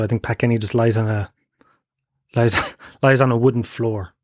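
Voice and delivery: ordinary delivery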